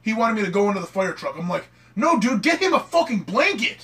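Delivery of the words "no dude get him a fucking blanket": higher, a touch louder mock yell; retold scream. Recalling yelling at firefighters to bring a blanket, mild mock yell. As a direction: snap back at the firefighter, half-yelling. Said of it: "I'm screaming at these fucking firefighters".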